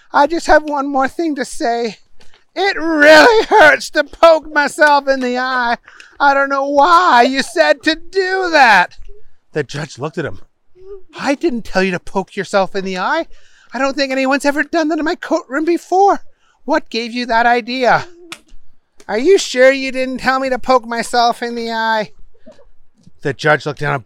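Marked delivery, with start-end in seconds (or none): 0.1-9.0 high-pitched complaint; whining Booger. A narrator's reading. Booger blaming the judge for his eye, high and whiny. 11.2-18.1 quick, high-pitched questioning; baffled judge. A narrator's reading. Judge puzzled by Booger's eye poke, quick and incredulous. 19.1-22.1 high-pitched questioning; doubting Booger. A narrator's reading. Booger doubting the judge's denial, high and insistent.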